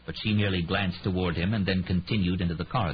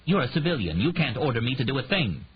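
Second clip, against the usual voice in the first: snapped back; 7 semitones higher than usual. A narrator's reading. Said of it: "snapped back".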